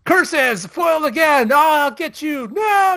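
high, loud mock villain rant; hammed-up cartoon villain, thwarted and raging; melodramatic villain meltdown. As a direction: wail it out with histrionic excess. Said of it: "no stupid emotional meltdown".

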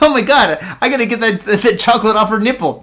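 lightly voiced gleeful surgeon; mock doctor. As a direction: ham up the greedy doctor. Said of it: "he's like".

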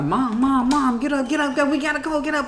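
high, urgent pleading to mom; panicked children waking their mother; imitating screaming kids. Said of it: "I heard them scream".

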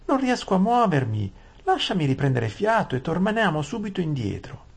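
very high breathless whine; panicked maid. Acted. Frightened maid begs Aravis to turn back, high whine. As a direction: Saralin begging, pitched far up.

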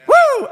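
very high ecstatic woo; joyful whoop. Real, not acted. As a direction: whoop it out with joy. Preacher's exclamation of joy.

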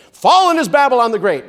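high, loud proclamation; angel's great shout. Acted. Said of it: "A great shout comes from an angel".

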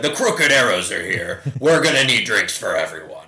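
loud proud announcement; hearty giant. Pretend. Giant announces his party at the tavern, loud and proud. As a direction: boom it to the whole tavern. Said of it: "He'd be like".

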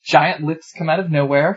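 ordinary delivery

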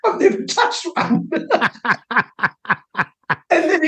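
high-pitched, laugh-choked words; laughing through words. Real, not acted. Telling the interviewer his bluff, laughing. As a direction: get the line out while cracking up.

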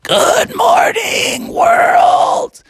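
very high, strained, scratchy croak; squeaky little frog with a scratchy throat. Acted. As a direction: holler slowly through a wrecked throat. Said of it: "only thing that came out of his voice".

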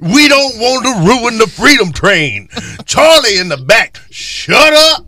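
high, loud mock-urgent character voice; hammed-up escape leader. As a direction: play a bossy leader frantically ordering the group around. Plays escaped slave leader on Underground Railroad.

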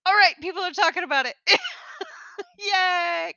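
excited. Retold or imitated. Excited about episode comments.

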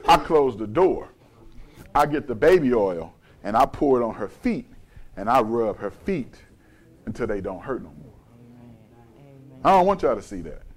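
low, hushed confiding tone; soft and low, with pauses between phrases; tender, intimate. Real, not acted. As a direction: confide it privately and gently. Intimate private worship of wife.

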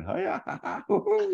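high, comic swashbuckling yelps; high fencing cries. Acted. Didymus fencing Sheppy with a ruler, high comic yelps. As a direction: squeal out playful sword-fight noises. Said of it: "he's back to Sheppy with the ruler going".

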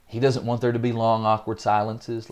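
normal talking voice

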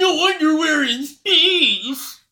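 very high, slow hammed-up proclamation; exaggerated high-pitched impression; mock George Lucas. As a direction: squeal it out slowly as a joke. Mock re-enactment of the George Lucas quote.

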